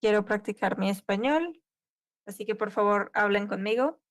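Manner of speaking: ordinary talk, nothing marked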